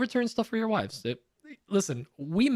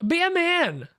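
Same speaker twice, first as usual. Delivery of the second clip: retold scolding; 8 semitones higher than usual. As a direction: tell him off, man to man, pitched up. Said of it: "my response was".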